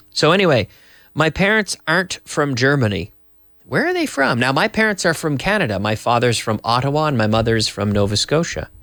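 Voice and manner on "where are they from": high questioning pitch; demo question. Shown as a demonstration. Teacher asking the class, high question. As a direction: ask it with pitch jumping up high. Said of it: "So here we have at the end of the sentence".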